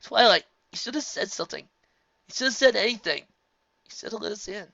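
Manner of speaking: high, loud distraught plea fading on the last line; a young dragon begging his friend; Spike pleading. A narrator's reading. Spike pleading with Twilight, distraught.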